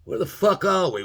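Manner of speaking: mock lost cry; lost tribe yelling. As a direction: shout it like you're hopelessly lost. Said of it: "they were always going".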